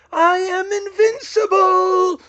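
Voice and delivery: very high, slow, loud scream; drawn-out villain shriek. A narrator's reading. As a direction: scream it in desperate defiance. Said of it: "he screamed".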